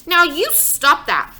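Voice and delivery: loud, high, slow scolding yell. Acted. Father yelling at Fudge to stop, slow and loud. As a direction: stop the child hard. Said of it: "my father yelled at Fudge".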